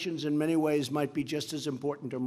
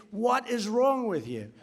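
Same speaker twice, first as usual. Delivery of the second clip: slow, high mock exasperation; hammed-up incredulous rebuke; exasperated; 6 semitones higher than usual. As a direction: scold them in mock disbelief, drawn out. Acts out scolding those who omit punctuation.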